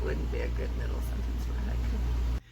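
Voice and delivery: low whispered correction; whispered aside. Acted. Teacher whispers a correction to class. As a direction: whisper it like a joke to yourself.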